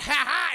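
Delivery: high, loud hammed-up villain laugh; gloating laugh. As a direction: crow a mock-triumphant "ha ha". Robber gloats as he leaves.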